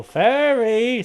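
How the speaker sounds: high, loud excited shout. Real, not acted. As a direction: burst out with delight. Excited outburst naming the fairy.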